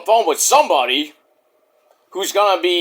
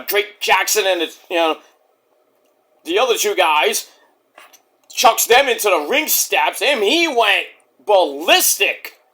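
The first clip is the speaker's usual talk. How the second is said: normal talking voice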